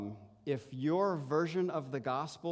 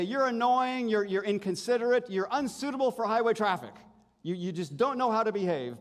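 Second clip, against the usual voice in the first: loud, higher scolding rant; the law scolding; 7 semitones higher, 5 dB louder than usual. Acted. Pastor voicing the law scolding the rude driver, loud and stern. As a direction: lecture like a stern accuser. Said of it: "the law would say".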